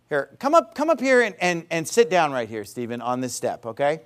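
usual speaking voice

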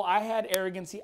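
ordinary talk

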